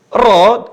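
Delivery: Ro said thick and full, pulled toward O; heavy Arabic ro. Shown as a demonstration. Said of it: "cara mengucapnya ketika berharakat fathah".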